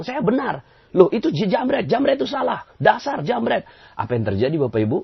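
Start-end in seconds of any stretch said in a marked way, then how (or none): 0.0-3.6 high, indignant accusing outburst; wrong way: blaming the snatcher. Shown as a demonstration. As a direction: snap at the thief self-righteously. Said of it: "kalau kita bilang".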